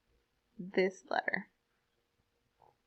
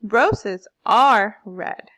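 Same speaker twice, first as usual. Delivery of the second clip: "Roses are red" very slow, swelling on the stresses; volume up and down; 9 dB louder, much slower than usual. Shown as a demonstration. Teacher showing how volume moves, "Roses are red" slow with exaggerated stress. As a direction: stretch "Roses are red", pushing "are" loud. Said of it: "volume in my voice goes up and down".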